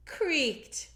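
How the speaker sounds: ordinary delivery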